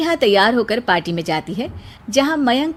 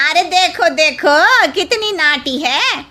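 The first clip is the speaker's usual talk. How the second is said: higher-pitched gossipy jeering; sneering neighbourhood gossip, pitched up; mocking neighbour; 6 semitones higher, 4 dB louder than usual. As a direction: jeer at the short bride like a nosy neighbour. Neighbour mocking the short bride.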